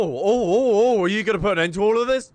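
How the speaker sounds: ordinary talk, nothing marked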